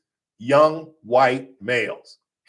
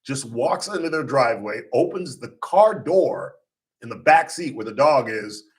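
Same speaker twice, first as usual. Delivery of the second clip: ordinary talk, nothing marked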